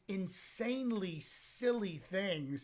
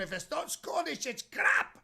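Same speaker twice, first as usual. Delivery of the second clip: loud, hammed-up Scottish sketch voice; over-the-top mock Scottish outburst; Scottish accent; 8 semitones higher, 5 dB louder than usual. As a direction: bellow it in a comic Scottish burr. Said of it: "he does his Scottish too".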